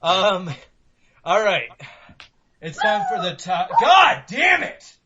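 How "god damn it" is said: loud, high, drawn-out outburst; furious shout. Real, not acted. Host yelling at the barking dog mid-recording, slow and loud. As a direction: yell it at the dog.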